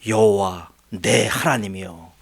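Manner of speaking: slow, drawn-out cry to the Lord; solemn appeal. Retold or imitated. David slowly calling on his God in protest. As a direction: call on God slowly, heavy with grievance. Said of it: "어떻게 다윗이 따지냐면요".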